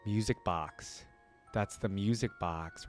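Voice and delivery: ordinary talk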